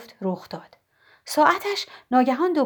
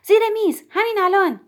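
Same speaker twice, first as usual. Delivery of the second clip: high, loud command to get under the table; urgent shout; 8 semitones higher, 4 dB louder than usual. Acted. Mrs Elm shouting to Nora to take cover, urgent loud yell. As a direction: yell it as the ceiling falls. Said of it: "خانم الم داد زد".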